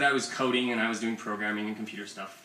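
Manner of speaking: normal talking voice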